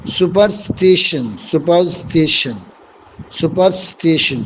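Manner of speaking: سپرسٹیشن spelled out slowly, syllable by syllable; سپرسٹیشن drawn out for the students to repeat; slow careful pronunciation. Shown as a demonstration. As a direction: say سپرسٹیشن slowly and clearly, as if to a class. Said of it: "teacher pronouncing the vocabulary word".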